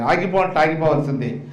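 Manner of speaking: ordinary talk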